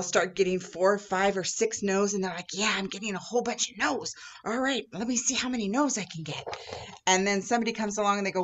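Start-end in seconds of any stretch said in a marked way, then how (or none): 2.5-6.4 lightly voiced girl, higher and keen; a hint of a pleased little girl; eager little girl. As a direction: sound like a kid chasing a new record. Girls counting their no's.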